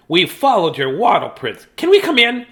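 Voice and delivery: high, loud pleading to come in; penguin sisters. A narrator's reading. Sisters asking Dudley to let them in, high and eager. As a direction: pipe up eagerly, loudly.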